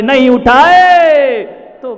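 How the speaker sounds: loud, long-drawn cry; exasperated shout. Real, not acted. Preacher on wife's no-win plight, shouting slowly. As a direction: stretch it out at full volume.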